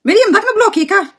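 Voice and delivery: high, loud threat to block; warning loudly. Real, not acted. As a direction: call her out with a firm warning.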